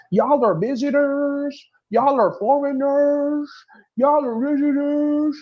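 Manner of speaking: high, slow, lips-puckered mock of her. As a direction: parody her prim pursed-lip delivery, stretching every word. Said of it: "she talk like she has to pucker her lips".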